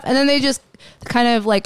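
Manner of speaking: ordinary talk, nothing marked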